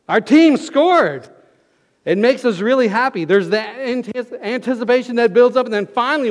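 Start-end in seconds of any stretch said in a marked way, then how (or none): 0.1-1.3 mock fan cheer. Acting out a fan's cheer.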